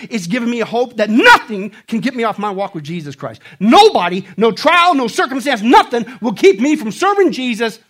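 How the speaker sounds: loud, high, rising sermon cry; preaching at full force. Acted. Preacher to the congregation, shouting his testimony. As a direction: preach it out with mounting fervor.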